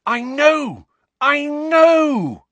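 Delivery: high, loud triumphant shout. Acted. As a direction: cry out in triumph. Triumphant voice from vision.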